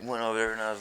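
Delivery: ordinary talk, nothing marked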